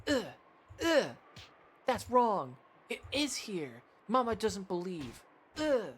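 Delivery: put-on high, petulant child whining at her mother; a high child's tantrum voice insisting she is right; whiny little girl. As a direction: stamp your foot and whine like a spoiled kid.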